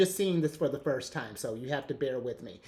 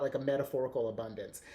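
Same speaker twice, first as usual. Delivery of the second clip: ordinary talk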